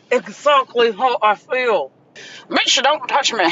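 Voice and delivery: lightly voiced sassy reply; mimicked man. The guy at the bar answering her, lightly mocked. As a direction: toss it off like the guy. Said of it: "And he was like".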